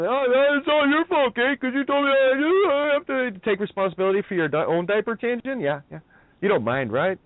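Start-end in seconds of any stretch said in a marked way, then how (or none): 0.1-5.6 shrill-free hammed-up parody of a blaming partner; mock whiny complainer. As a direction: whine the blame back mockingly. Mocking a blaming woman's voice.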